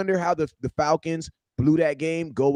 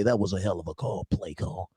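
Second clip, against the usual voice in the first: announcer voice; 7 semitones lower, 8 dB quieter than usual. Retold or imitated. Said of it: "want the announcer to say".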